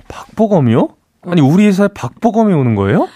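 high, rising incredulous questions; acted astonishment. Office worker hearing of the new team leader, hammed-up astonishment. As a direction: swoop up high in hammed-up disbelief. Acting listener hearing star name joins office.

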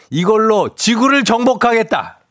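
high, loud villain proclamation; booming arrogant alien overlord. Acted. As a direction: boom it out like a conquering overlord. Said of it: "이게 무슨 소리야?".

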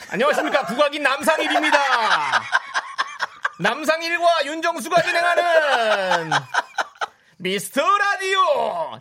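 loud, slow showman radio intro; announcer voice. Acted. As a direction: belt it out like a radio host. Radio show opening announcer voice.

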